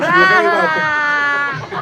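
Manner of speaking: high, slow, shouted welcome; hammed-up gracious bellow; mock welcoming shout.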